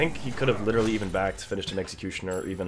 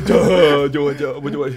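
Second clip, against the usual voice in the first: laughing mock confusion; 4 semitones higher, 10 dB louder, slower than usual. Mocking a confused player, laughing.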